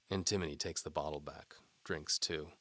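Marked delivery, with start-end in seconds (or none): none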